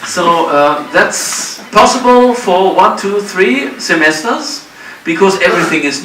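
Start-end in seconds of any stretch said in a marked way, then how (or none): none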